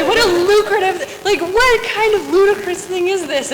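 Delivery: high, loud, indignant rant; outraged disbelief. Retold or imitated. Storyteller reacting to the button industry, indignant.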